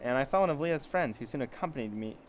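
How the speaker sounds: plain reading voice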